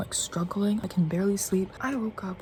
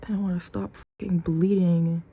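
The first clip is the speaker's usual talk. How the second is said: ordinary talk, nothing marked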